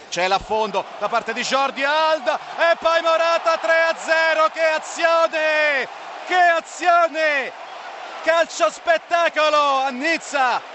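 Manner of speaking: shouted goal, slow and loud; goal commentary shout. Real, not acted. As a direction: roar the goal and the play. Third goal scored.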